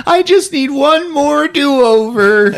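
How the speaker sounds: high, slow pleading whine; hammed-up tearful begging; mock almost crying. Candy striper botching a shot, mock tearful plea. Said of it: "shaking and almost crying".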